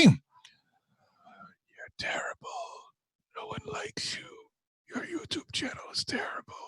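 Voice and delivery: breathy insulting whisper; savage ASMR whisper. Pretend. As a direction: whisper the insults close to the mic. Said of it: "Let me give you some savage A S M R".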